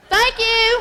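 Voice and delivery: high, loud stage thank-you; loud sign-off. Real, not acted. Comic closing her set to the audience, loud and high. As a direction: call it out to the whole crowd.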